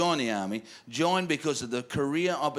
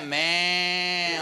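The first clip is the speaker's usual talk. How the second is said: long drawn-out bleat; imitated sheep noise; mock sheep bleat; 3 semitones higher than usual. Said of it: "What's with the sheep jokes".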